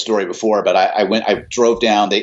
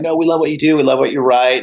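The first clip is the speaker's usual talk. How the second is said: higher-pitched, eager bandmates' voice; retold enthusiastic protest, high; insistent praise; 5 semitones higher, faster than usual. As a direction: gush it over the doubts, pitched up.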